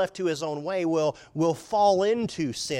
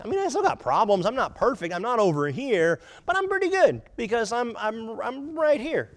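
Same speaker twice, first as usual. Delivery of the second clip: smug self-talk; 6 semitones higher, 4 dB louder than usual. Pretend. Voicing smug self-justifying reasoning.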